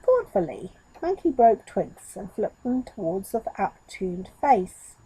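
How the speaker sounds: ordinary delivery, nothing marked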